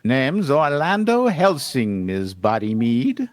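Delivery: very slow, self-satisfied cartoon coyote; boastful cartoon genius, stretched syllables; Wile E. Coyote. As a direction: draw it out with swooping pitch. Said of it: "I'm gonna be like the Wi-, Wile E. Coyote voice".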